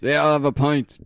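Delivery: mock bar order. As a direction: play a vague punter ordering. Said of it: "imagined bar order".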